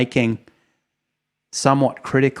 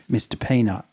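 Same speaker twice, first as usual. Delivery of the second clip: ordinary delivery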